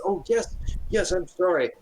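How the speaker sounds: high, hurried yes yes; eager to please, caught out; flustered priest. Acted. Priest answering Mother Ivy, high and flustered.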